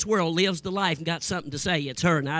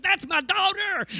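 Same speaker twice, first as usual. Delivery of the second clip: high, slow mock reveal; deadpan punchline; 12 semitones higher, slower than usual. Pastor to preacher, a slow high mock reveal. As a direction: drop the reveal slowly up high.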